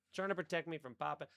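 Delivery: ordinary delivery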